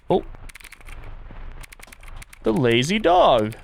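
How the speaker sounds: ordinary talk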